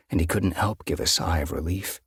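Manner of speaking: plain reading voice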